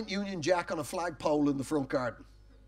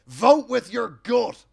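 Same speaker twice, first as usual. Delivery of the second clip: slow, louder mock headline; tabloid slogan; 3 semitones higher, 9 dB louder, slower than usual. Comedian quoting the tabloid headline as a slow mock proclamation. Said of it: "tabloid ... said".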